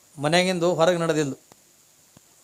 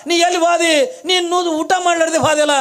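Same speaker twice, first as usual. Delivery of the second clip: wailing cry; 9 semitones higher, 4 dB louder than usual. Acted. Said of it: "ಕಣ್ಣೀರು ಹಾಕ್ಲಿಕ್ಕತ್ತ".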